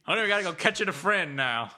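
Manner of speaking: joking Southern family voice; Southern accent. Pretend. Spouse talks of catching the bird a friend, Southern accent. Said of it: "giving your family a southern accent".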